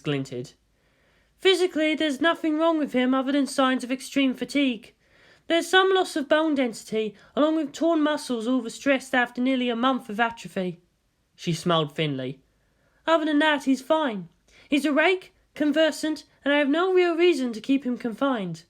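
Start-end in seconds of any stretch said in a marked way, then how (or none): 1.4-10.8 higher, lightly voiced female scientist; woman doctor voice. 13.1-18.6 higher, lightly voiced female scientist; woman doctor voice.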